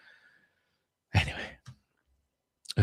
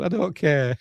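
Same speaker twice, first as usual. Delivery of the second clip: high-pitched mock indifference; Dylan; 8 semitones higher than usual. Prisoner shrugging off Ripley's plea, mock dismissive. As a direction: brush it off in a raised voice. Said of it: "And he goes".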